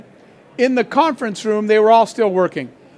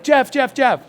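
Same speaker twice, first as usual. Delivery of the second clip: higher, urgent repeated name-calling, acted out; hurried mock alarm; mock frantic calling; 4 semitones higher than usual. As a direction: imitate worked-up vice presidents calling their boss. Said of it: "Why are you guys all excited".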